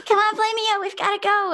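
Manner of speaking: high, urgent character call. Acted. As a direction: hurry them along, pitched high. Character urging dragon to leave.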